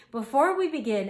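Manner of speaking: beaming. A narrator's reading. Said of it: "Owl beamed".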